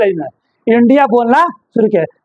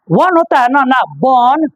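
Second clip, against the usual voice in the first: ordinary delivery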